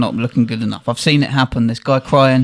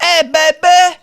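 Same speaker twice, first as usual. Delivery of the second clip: high sing-song come-on, louder; imitating a sex worker; 14 semitones higher, 3 dB louder than usual. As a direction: call out a cheeky offer in a put-on high voice. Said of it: "they're like".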